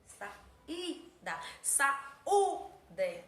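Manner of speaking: Sa, i, da then Sa, u, de, each syllable apart; syllables again. Shown as a demonstration. Teacher to pupils, separating saída and saúde.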